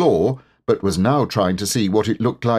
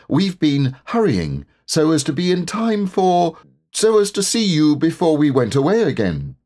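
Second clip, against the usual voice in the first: high, earnest bear voice; higher-pitched Pooh, covering a slip; Pooh, explaining himself; 9 semitones higher, 3 dB louder than usual. A narrator's reading. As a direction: explain politely, catching the slip.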